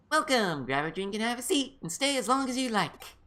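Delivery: high, bright welcome; cheerful host voice. Acted. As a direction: greet customers sweetly. Voices the cafe greeter.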